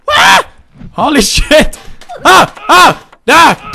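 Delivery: short, high, loud screams; panicked yelps. Pretend. Players under fire in game, yelping in panic. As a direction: yelp as each shot hits.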